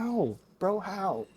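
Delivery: high-pitched incredulous question; high, bewildered imitation; mock bafflement. Acting out baffled hero.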